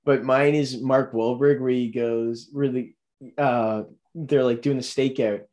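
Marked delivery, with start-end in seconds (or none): none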